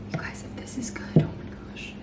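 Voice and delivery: hushed excited whisper. Real, not acted. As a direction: whisper it, holding your breath. Whispering before tasting the drink.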